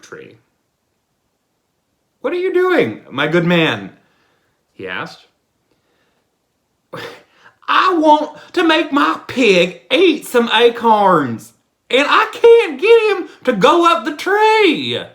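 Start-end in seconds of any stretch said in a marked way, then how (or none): 2.2-4.0 much higher, louder call; high questioning voice. Acted. Lover questions pig man. 7.7-15.0 much higher, louder and slow; slow high bumpkin voice; high silly fool. Acted. Pig man explains himself.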